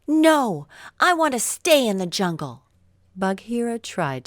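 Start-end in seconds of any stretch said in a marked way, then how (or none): 0.1-2.6 put-on high, loud protesting boy's cry; a child refusing to leave, shouting it out. Mowgli refuses to leave the jungle, high loud cry. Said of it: "Mowgli cried".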